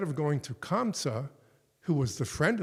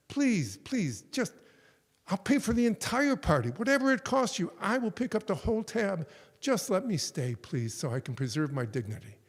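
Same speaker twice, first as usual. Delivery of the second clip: desperate begging; 7 semitones higher than usual. Retold or imitated. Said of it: "one more time he begs".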